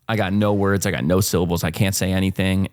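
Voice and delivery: usual speaking voice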